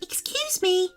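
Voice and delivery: high, polite little fairy voice. Acted. Fairy politely asking the squirrel for food. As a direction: ask sweetly and politely. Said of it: "asked Rebecca politely".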